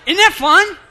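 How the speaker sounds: high, loud call to the crowd. Real, not acted. Calling the audience back after discussion.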